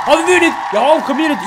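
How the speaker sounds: surprised. Real, not acted. Surprised at winning a trophy.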